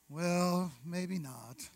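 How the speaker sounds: soft, droopy and defeated; a mopey donkey giving up; gloomy Eeyore. Acted. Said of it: "The Eeyore spirit comes on him".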